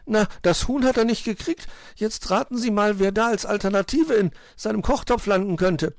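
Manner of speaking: tiny bird fretting in a high voice; frightened piping bird. A narrator's reading. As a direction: pipe it up anxiously. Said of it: "piepste der kleine Vogel verängstigt".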